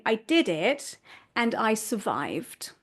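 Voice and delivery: slow, deliberate and proud; slow proud look back; slow reflective declaration. Shown as a demonstration. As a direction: savour each word slowly. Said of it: "end up reflecting back and saying".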